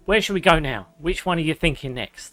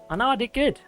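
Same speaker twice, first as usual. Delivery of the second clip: mock proud; 9 semitones higher than usual. Streamer praising himself playfully.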